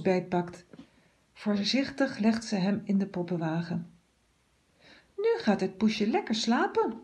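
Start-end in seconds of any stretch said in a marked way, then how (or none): 5.2-6.9 light, higher girl's voice playing mother; babbling little girl. A narrator's reading. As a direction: coo to the cat like a doll. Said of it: "babbelt ze". (een kinderlijk babbelend meisje)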